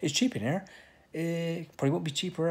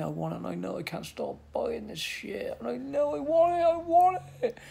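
desperate craving; 7 semitones higher than usual. Real, not acted. Pleading craving, rising.

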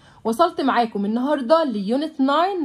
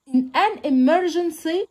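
slow textbook reading; 4 semitones higher, much slower than usual. Shown as a demonstration. Teacher reading the lesson text aloud to pupils, slow and clear. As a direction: read each English phrase out slowly for the class.

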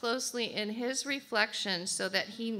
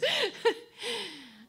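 high laugh; real laugh; 11 semitones higher than usual. Real, not acted. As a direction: laugh it off, amused. Laughs at eyelashes point.

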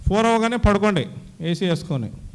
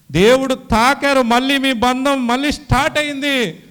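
much louder and higher, slowing on తాకారు; emphatic repeat; 6 semitones higher, 9 dB louder than usual. Retold or imitated. Brother on the phone proclaiming God touched him, in a loud, slow, emphatic voice. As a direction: proclaim it with joyful force.

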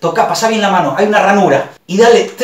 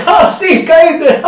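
higher, laughing; laughing gotcha; 6 semitones higher, 3 dB louder, much slower than usual. Retold or imitated. The prankster gloating, laughing. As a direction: crow it through a laugh. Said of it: "me dice".

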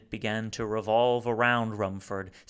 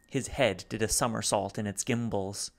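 ordinary delivery

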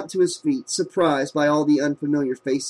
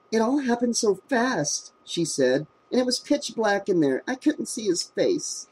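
shaken; 7 semitones higher, 4 dB quieter than usual. A narrator's reading. Shaken victim tells officer.

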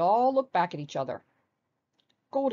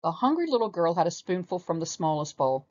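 ordinary delivery

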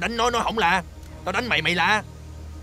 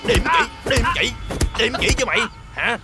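loud, high repeated shouts; angry beating; 3 semitones higher, 4 dB louder than usual. Acted. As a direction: shout the words with each blow. Henchman beats to force confession. (vừa đánh vừa quát dồn)

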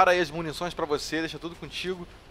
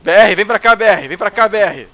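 loud urgent call across the map; 4 semitones higher, 14 dB louder than usual. Real, not acted. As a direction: shout for him to come over. Calling out to a friendly player.

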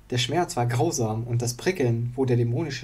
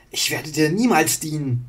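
defiant refusal; 4 semitones higher, 4 dB louder than usual. Acted. Wandel refuses Illidan.

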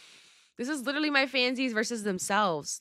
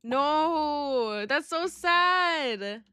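high, slow, drawn-out whine; exaggerated pouty dismay; playful lament; 5 semitones higher, much slower than usual. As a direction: stretch it into a mock-sad wail. Playful lament over bald character.

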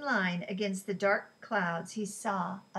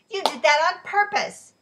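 loud, higher indignant protest; outraged loud accusation; 9 semitones higher, 12 dB louder than usual. A narrator's reading. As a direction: cry foul at the cheater. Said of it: "Ellie protested".

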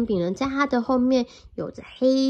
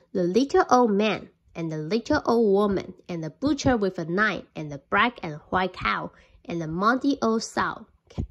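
plain reading voice

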